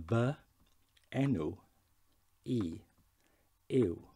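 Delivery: "Fy enw i yw" slow, each word apart; Welsh, word by word. Shown as a demonstration. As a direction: say "Fy enw i yw" as vuh en-oo ee yoo, pausing between words. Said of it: "So have a practice of that".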